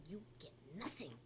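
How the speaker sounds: quick, hushed angry Peruvian-accented imitation; thick Peruvian accent hissed through gritted teeth; mom's Peruvian accent. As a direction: whisper it like a furious Peruvian mom. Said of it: "like that".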